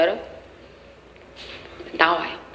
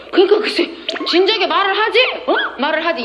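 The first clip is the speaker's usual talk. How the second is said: exasperated husband; 8 semitones higher than usual. Retold or imitated. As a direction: throw it back as a fed-up husband. Husband retorts to wife.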